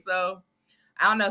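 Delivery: ordinary talk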